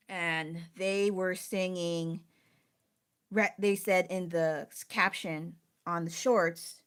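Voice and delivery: normal talking voice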